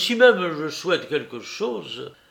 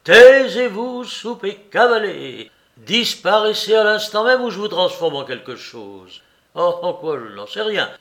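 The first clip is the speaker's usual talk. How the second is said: bien plus fort et plus aigu, exaspéré; chasse-le avec rage; colère furieuse; 4 semitones higher, 4 dB louder than usual. Acted. Larrier furieux chasse Soupe. (Larrier chasse Soupe avec fureur)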